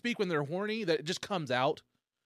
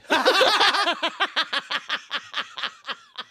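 loud witchy cackle; evil cackle; 10 semitones higher, 8 dB louder than usual. Real, not acted. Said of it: "that's my witch laugh".

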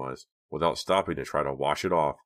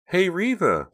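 much higher, louder friendly call; receptionist calling; 6 dB louder, slower than usual. A narrator's reading. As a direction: call her name brightly from the desk.

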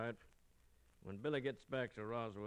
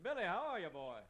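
hearty greeting; 8 semitones higher, 4 dB louder than usual. Acted. Greeting friend out of jail.

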